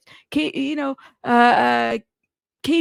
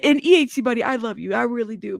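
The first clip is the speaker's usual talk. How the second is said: ordinary talk, nothing marked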